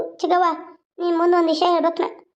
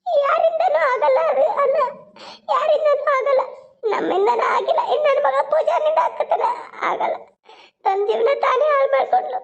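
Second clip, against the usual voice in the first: sobbing; 6 semitones higher than usual. Acted. Mother sobbing to her brother-in-law, hopeless and tearful. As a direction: cry it out, hopeless. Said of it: "ಅಳಬೇಡ".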